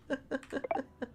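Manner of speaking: soft chuckle; quiet, brief laughing fit. Real, not acted. Laughs at own remark.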